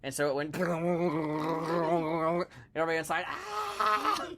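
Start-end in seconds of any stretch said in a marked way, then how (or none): 3.3-4.3 high, far-off scream of the whole crew. Acted. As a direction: shriek as the sub rings like a bell. Everyone inside the struck sub.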